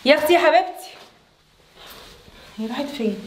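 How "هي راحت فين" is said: hushed question. Acted. Wife's sister asks Emad quietly where her sister went. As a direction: keep it quiet so no one overhears. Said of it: "ايه؟".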